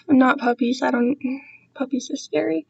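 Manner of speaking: normal talking voice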